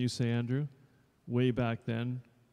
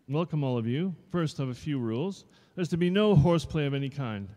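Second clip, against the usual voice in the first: louder, a touch higher stern teacher; strict dance teacher; 4 semitones higher, 7 dB louder than usual. Acted. Dance teacher addressing new pupils, mildly stern. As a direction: lay down the rules.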